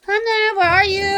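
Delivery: loud, high call. Real, not acted. Owner calling loudly for her dog. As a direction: call across the house.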